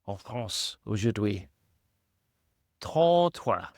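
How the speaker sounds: put-on French accent. Hammed-up French number.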